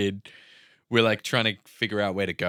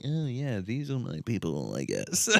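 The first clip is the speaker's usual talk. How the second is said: wry; 5 dB quieter than usual. Retold or imitated. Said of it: "She was like".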